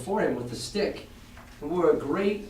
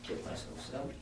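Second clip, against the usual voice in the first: whispered; 15 dB quieter than usual. Acted. Captain voiced in a whisper.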